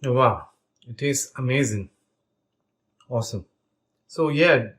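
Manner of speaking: talking through a mouthful; words round a bite of food; mouth full. Real, not acted. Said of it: "sorry, I have a bite in my mouth".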